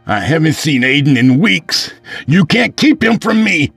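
high, loud outraged plea; desperate protest. Acted. Father confronts estranged wife, loud desperate protest. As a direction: shout your claim to your child.

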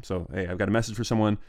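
usual speaking voice

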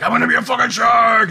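loud, high shark battle cry; shouting. Retold or imitated. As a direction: yell it like a gleeful predator. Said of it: "and I'm like".

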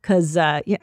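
usual speaking voice

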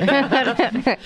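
high laugh; burst of laughter. Real, not acted. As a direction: break into a laugh. Laughing at own joke.

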